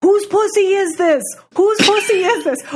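high, loud imitation of a man yelling; mock yelling. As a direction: yell it in a high, hammed-up voice. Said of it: "starts, like, yelling".

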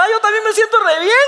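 high, put-on delight; mock-cheerful parent. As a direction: gush with exaggerated, sarcastic happiness. Sarcastic dad echoing son's joy.

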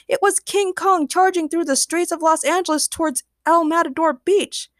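high, dramatic narration; excited reveal. A narrator's reading. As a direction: announce the monster breathlessly, with excitement. Narrator reveals the giant monster.

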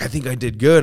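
a touch of confidence, rising finish; mildly upbeat quote. Retold or imitated. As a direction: imitate a friend's hopeful verdict. Friend's upbeat verdict, set against his.

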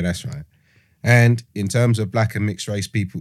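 usual speaking voice

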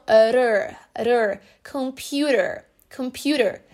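"computer" with T rolled into an R sound; American flapped T. Shown as a demonstration. Teacher breaking down the sound, American flapped T. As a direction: isolate the tapped "r", then slow "computer". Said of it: "o T entre as vogais U e R".

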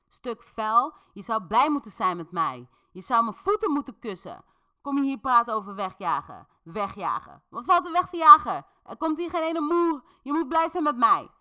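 high, loud furious tirade building to a full shout; Dis shouting fiercely. A narrator's reading. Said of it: "reageert Dis heel fel en begint te schreeuwen".

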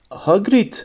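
understated narrator's shout; mild acted cry. A narrator's reading. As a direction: call the name in alarm, restrained. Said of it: "diye bağırdı Hermione".

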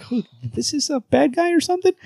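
very high incredulous question; baffled disbelief. Retold or imitated. Reader reacting to Superman talking like a villain, baffled. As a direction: shoot the voice way up, mock baffled.

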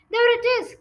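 high, loud exclamation; excited cry. Acted. Brother crying out at the mysterious voice, high and loud. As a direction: cry out in alarm. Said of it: "cried the brother".

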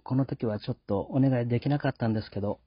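normal talking voice

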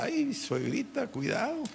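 soft, teasing mock warning to the mother-in-law. Young man mocking his mother-in-law's preaching, softly teasing. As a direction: scoff at her faith softly. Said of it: "Yo me reía de mi suegra".

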